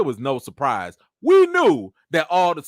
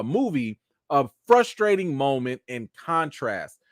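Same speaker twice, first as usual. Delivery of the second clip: normal talking voice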